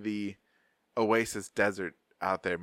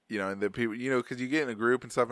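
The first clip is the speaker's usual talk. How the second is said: normal talking voice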